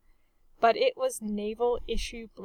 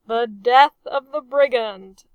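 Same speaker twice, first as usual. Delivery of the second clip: loud but dutiful echo; obedient reply; 2 semitones higher, 12 dB louder than usual. A narrator's reading. Officers dutifully echoing the captain's toast. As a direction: answer by rote, as ordered. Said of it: "they replied obediently".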